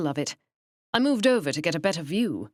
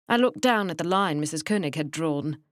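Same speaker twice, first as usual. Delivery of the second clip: usual speaking voice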